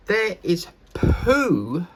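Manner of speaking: slow, loud drawn-out reveal; dramatic pause. Real, not acted. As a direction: drag out the punchline. Building the punchline.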